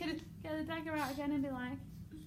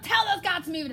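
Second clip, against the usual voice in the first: loud, higher barked order; commanding; 6 semitones higher, 8 dB louder, faster than usual. Shown as a demonstration. King ordering his guards, commanding shout. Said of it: "Tell those guards, that can be command".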